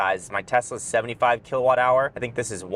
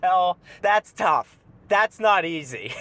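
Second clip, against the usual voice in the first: dismissive mock; 5 semitones higher, 3 dB louder, slower than usual. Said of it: "they're like".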